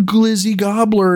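high, slow, campy Ooh; hammed-up flirty streamer; mock streamer voice. Mocking the NPC streamers on TikTok, campy and high.

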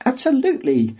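high, bouncing excitement; excited. A narrator's reading. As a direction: blurt it out with glee. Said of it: "Gödel bounces up and down excitedly".

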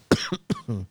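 harsh hacking cough; smoker's cough. Real, not acted. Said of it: "Smoker's cough".

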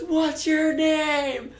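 put-on high, slow drawn-out drunk question; drunk girl voice. Friend imitating a drunk girl asking his name, slow and high. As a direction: stretch it out like someone wasted. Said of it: "she goes".

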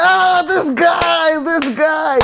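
high, drawn-out crowd reaction; delighted cheering. Acted. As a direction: whoop it up like an adoring fan. Acting out everyone's delighted reaction.

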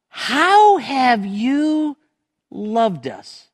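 high and very slow, drawn-out disbelief; imitating a people doubting God; mock indignant retort. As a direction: stretch the how into a wounded challenge. Israel retorts to God's love.

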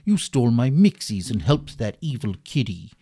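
loud greeting that turns into vengeful menace; menacing villain. Acted. Hypnotizer confronting the thief of his mixies, vengeful.

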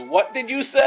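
high, loud mock outrage; outraged wife. Wife turns the corner on the rav, high outraged demand. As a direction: shriek it as a wife who overheard. Wife catches the rav's advice.

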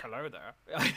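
lightly voiced Obi-Wan hello; a hint of Ewan McGregor, rising; Obi-Wan greeting. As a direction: greet them like a mild Jedi. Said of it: "Yours was Ewan McGregor".

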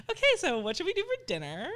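high, mock-breezy change of subject; creepily sudden cheer. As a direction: switch abruptly to a bright, high lilt. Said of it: "creepily switching my thought".

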